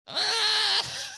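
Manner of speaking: sudden high yelp of pain. Retold or imitated. Pained customer on massage table, high yelp. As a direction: cry out as she presses hard.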